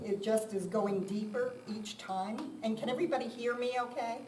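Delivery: usual speaking voice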